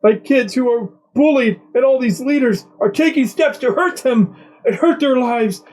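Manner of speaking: high, whiny fake-crying voice; mock sob. Mocking the press secretary's crying podcast answer, whiny mock sob. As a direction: ham up her fake tears. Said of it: "starting to audibly sob".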